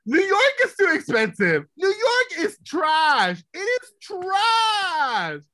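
high, loud, slow wail that New York is trash. Real, not acted. Ranting to friends that New York is trash, loud and wailing. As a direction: drag it out in a loud, half-laughing wail.

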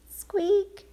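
tiny squeaky mouse cry; high mouse squeak. Acted.

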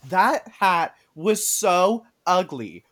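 slow, drawn-out disgust; disgusted disbelief. Real, not acted. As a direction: stretch out the outrage. Outraged about ugly hat.

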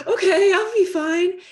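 high, loud, tearful gasp then okay. Retold or imitated. When the therapist says time's up, a high tearful gasp, then okay. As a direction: gasp back tears and force out okay. Acting herself at session's end.

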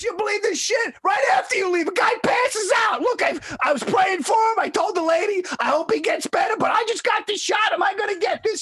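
very high, sustained hysterical rant; mock screaming. Friend phoning after a man passed out, mock screaming. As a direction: imitate a panicked friend screaming down the phone. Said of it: "he's on the phone screaming at me".

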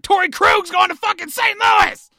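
furious fan shouting; angry shout. Real, not acted. Fan ranting about lost player.

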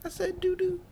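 high squeaky mock-sweet voice. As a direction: talk like a cartoon chipmunk. Same high put-on voice.

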